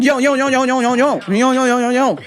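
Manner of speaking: rhythmic, repetitive hype-man chant; punchy mock rap patter; mock wannabe rapper. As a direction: imitate an amateur rapper hyping himself up. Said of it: "a rap concert at my work".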